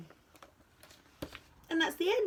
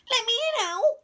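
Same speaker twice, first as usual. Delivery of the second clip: high, loud plea; calling out; 14 semitones higher, 7 dB louder than usual. A narrator's reading. Mouse outside Owl's door in the storm, calling out. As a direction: call through a shut door. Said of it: "called Mouse".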